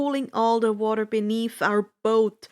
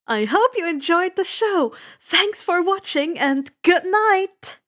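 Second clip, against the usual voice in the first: showman sign-off; 7 semitones higher, 6 dB louder than usual. A narrator's reading. Flashes smile at cameras.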